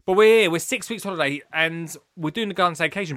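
normal talking voice

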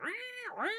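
very high, drawn-out cat yowls; imitated cat yowl. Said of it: "like, cat yowling".

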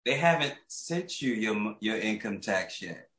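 soft, slow, gentle explaining; sweet, patient clerk. Retold or imitated. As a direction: break it to them slowly and softly. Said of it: "she's so sweet. More patient".

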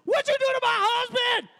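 high, very loud mock screaming threat; furious wife. Wife confronting driver who hit her husband, mock furious screaming.

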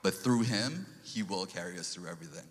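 ordinary talk

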